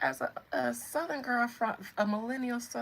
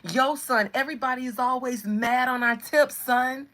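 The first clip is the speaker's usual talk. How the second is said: loud, higher mock New Yorker; nachgeahmte New Yorkerin; 5 semitones higher, 8 dB louder than usual. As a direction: puff up like an aggrieved New Yorker. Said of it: "Or whatever y'all say".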